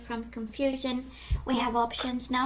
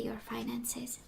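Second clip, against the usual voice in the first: whispering; 9 dB quieter, slower than usual. Real, not acted. Soft aside in tarot reading.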